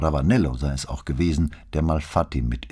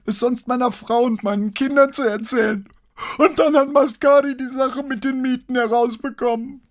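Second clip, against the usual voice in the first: hoch gepresst, den Tränen nah; hohe, gepresste Stimme; 5 dB louder than usual. A narrator's reading. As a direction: mit enger Kehle herauspressen. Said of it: "mit hoher, gepresster Stimme, offenbar den Tränen nah". (gepresst und weinerlich)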